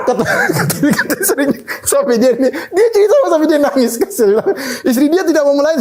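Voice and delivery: high-pitched, laughing through the words; real amusement bubbling into speech; laughing retelling. Real, not acted. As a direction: barely get the story out for laughing. Amused while retelling the wife's reply.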